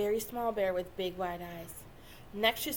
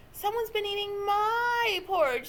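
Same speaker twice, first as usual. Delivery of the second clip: higher squeaky cub voice; squeaky Baby Bear; 8 semitones higher, 4 dB louder than usual. A narrator's reading. Baby Bear finds his porridge gone, squeaking. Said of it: "squeaked Baby Bear".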